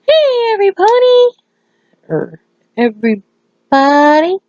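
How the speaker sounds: imitating a perky performer; excited stage greeting. Pinky greets the crowd from stage.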